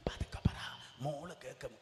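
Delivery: imitating a wife's hushed whisper; wife hushing her husband, whispered. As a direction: whisper it so the daughter won't hear. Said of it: "ഭാര്യ ഭർത്താവിനോട് ഇങ്ങനെയാണ് പറയുന്നത്".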